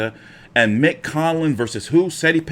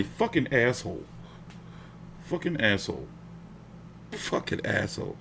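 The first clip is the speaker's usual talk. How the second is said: softer each time, ending in a whisper; fading curse; 3 semitones lower, 11 dB quieter than usual. Real, not acted. Streamer curses the boxer, fading to a whisper. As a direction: let the insult die away to a breath.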